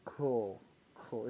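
ordinary talk, nothing marked

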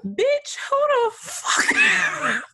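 high, slow shocked squeal; outraged disbelief. Retold or imitated. Retelling reading her old boss's note, a high slow shocked squeal. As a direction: shriek it in disbelief. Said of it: "I was like".

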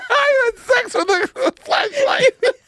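words squeezed out slowly through big laughter; can barely get the line out for laughing. Real, not acted. Podcast host cracking up at his own story, laughing. Repeats it after being teased.

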